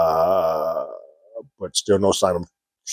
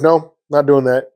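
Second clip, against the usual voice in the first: mock refusal; 6 semitones higher, 5 dB louder than usual. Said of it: "Solo's like".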